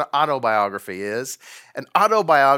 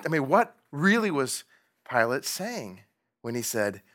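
ordinary delivery

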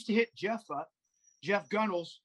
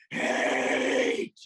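long, high, loud imitated yell; Johnny Haidt yelling; 6 semitones higher, 6 dB louder than usual. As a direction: bellow it like the friend's drawn-out battle cry. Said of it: "used to go around all the time just going".

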